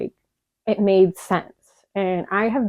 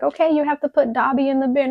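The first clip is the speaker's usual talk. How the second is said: much higher, brisk agent voice; imitating TSA agent; 7 semitones higher than usual. TSA officer telling her to bin the plush, high brisk voice. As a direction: play the chirpy security officer. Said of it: "she's like".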